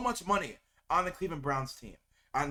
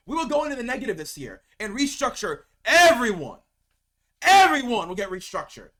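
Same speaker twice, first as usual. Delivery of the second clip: real angry rant; 7 dB louder than usual. Real, not acted. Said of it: "I'm genuinely angry".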